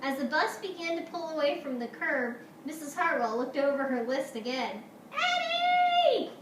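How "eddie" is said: high, loud teacher's yell; alarmed shout, pitch leaping way up. Acted. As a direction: shout urgently to halt a child. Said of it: "she yelled".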